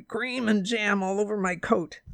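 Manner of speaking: sour taxi driver's complaint; disgusted grievance; bitter. A narrator's reading. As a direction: grumble bitterly. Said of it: "he said bitterly".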